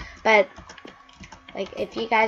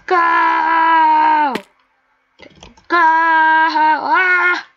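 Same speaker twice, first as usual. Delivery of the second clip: loud high yell; shout; 7 semitones higher, 7 dB louder, much slower than usual. Real, not acted. Gamer leaping, loud high shout. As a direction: belt it out at the jump.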